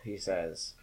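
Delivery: ordinary delivery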